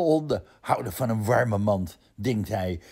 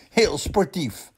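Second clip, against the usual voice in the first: opgewekt sportief; 6 semitones higher, slower than usual. Acted. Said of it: "zegt mama".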